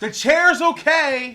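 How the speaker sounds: loud, high, stretched call; mock crowd shout. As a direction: call it out slowly to the whole room. Acting out the shouted reassurance.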